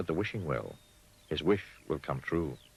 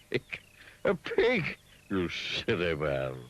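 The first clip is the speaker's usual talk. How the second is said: mocking laugh; slower than usual. A narrator's reading. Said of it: "laughed down his trunk".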